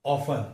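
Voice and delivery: often as OF-un; no t sound in often; silent T. Shown as a demonstration. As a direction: drop the t in often. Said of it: "ट sound यहाँ पे silent".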